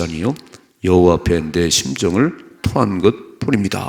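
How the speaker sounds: loud, measured Bible verse; firm, projected scripture read-out. Acted. Reads the Bible verse aloud.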